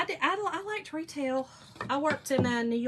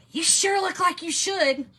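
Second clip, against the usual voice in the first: raised voice, higher and louder; loud angry customer; 6 semitones higher, 7 dB louder than usual. Retold or imitated. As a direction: imitate a man getting loud with a clerk. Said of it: "he got loud with me".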